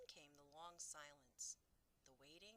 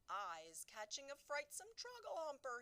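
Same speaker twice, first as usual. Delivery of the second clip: higher, louder horrified cry; 10 semitones higher, 9 dB louder than usual. Acted. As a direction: cry it out in horror. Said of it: "he cried".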